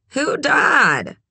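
high, half-awake question; muffled, groggy. A narrator's reading. Red woken by a phone call, muffled and groggy. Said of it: "Her voice muffled like she was talking through her pillow".

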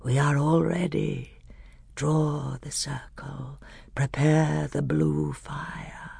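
soft, slow whispered incantation orders; grey, terrible voice. Acted. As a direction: murmur the ritual steps darkly. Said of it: "said the grey voice".

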